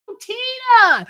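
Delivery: put-on very high little-girl cry; mock distressed call. [name] trying to stop Tina leaving, high mock distressed call. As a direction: call after her in a squeaky put-on pitch.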